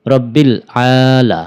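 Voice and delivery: "রব্বিল আলা" with the a held slowly; long a. Shown as a demonstration. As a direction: draw out the a of "আলা". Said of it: "একালিপ টেনে পড়তে হবে".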